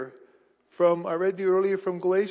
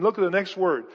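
ordinary talk, nothing marked

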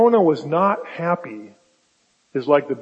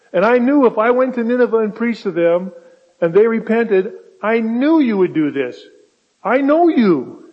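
higher and louder, accusing God; exasperated prophet; 5 semitones higher, 5 dB louder than usual. Retold or imitated. Preacher voicing Jonah scolding God, higher, louder, exasperated. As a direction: Jonah throws it back at God, fed up. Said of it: "He says".

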